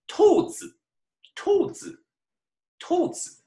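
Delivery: put-on teacher modelling 兔子 for kids; very high squeak. Shown as a demonstration. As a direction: squeak 兔子 like a tiny rabbit. Rabbit word sung high.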